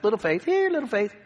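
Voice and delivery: high, playful little call; mock nickname call. Jesus calling the apostles by their nickname, in a high mock call.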